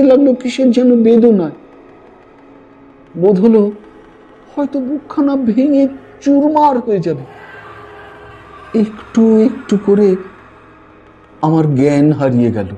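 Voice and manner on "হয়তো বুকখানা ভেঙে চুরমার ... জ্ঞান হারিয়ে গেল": usual speaking voice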